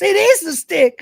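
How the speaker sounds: high loud panicked yell; panicked cry. Retold or imitated. Cat finds stick is the hawk, loud panicked yell. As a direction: cry out in alarm.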